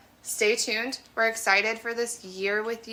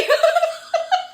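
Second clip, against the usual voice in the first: loud high laugh; 6 dB louder than usual. Real, not acted. Laughing at running into rival.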